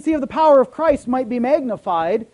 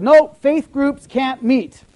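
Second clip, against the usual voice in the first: loud, slow, higher-pitched decree; mimicked official refusal; 4 semitones higher, 6 dB louder, slower than usual. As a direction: lay down the ban, slowly and emphatically. Mimics officials banning church meetings.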